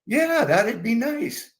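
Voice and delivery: put-on high, slow sweet old lady; dreamy mom. Stoned mom accepting dinner.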